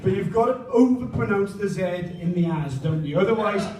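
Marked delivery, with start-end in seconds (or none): none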